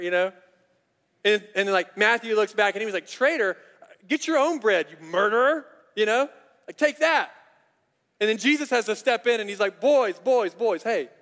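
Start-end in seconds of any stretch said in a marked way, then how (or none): 3.1-5.7 loud, higher, offended comeback; mock indignant retort. Matthew snapping back at Simon over bread, mock outraged. 6.8-7.3 high, loud teasing jab; mock jab. Matthew gloating at Simon, high and loud mock jab. Playful retort in the quarrel. 9.8-11.1 louder, higher call to break it up; calling boys to order. Retold or imitated. Jesus breaking up the disciples' quarrel, loud call.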